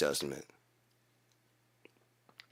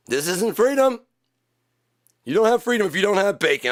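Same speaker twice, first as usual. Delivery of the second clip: indignant outrage; 8 semitones higher, 3 dB louder than usual. Acted. Outraged character.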